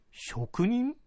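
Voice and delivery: high, startled question; a lord caught off guard; surprised lord. Acted.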